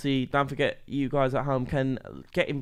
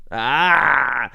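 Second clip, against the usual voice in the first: loud drawn-out mock cry; mock scream; 10 dB louder than usual. Beaten in the friendly argument over the game, mock scream. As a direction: let out a loud, playful "Ah!".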